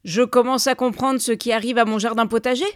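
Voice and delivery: loud, accusing indignation; feigned fury. A narrator's reading. Neighbour accuses husband over his garden, feigned fury. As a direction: accuse them with put-on outrage. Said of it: "prenant un air furieux".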